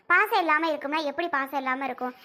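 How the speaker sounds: ordinary talk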